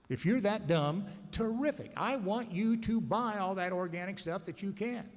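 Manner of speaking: real sarcasm, pitch jumping up on the praise; sarcastic approval. Real, not acted. Speaker mocks organic buyers to the room, sarcastic.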